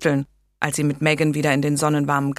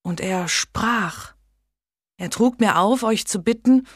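a lightly voiced young woman, higher, telling of a vision; earnest young lady; 6 semitones higher than usual. Megan quotes the saint.

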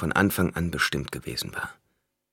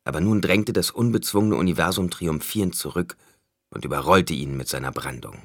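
plain reading voice

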